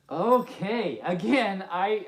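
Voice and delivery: ordinary delivery, nothing marked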